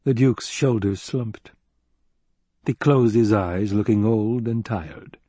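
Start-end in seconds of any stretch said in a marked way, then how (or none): none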